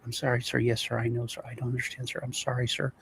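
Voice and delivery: soft, hushed run of apologies, imitating a cowed official; massively subservient. Police chief placating the cartel boss, subservient and soft. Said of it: "the tone, this guy was, like, massively subservient".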